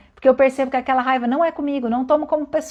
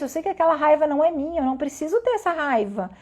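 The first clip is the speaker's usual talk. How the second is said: usual speaking voice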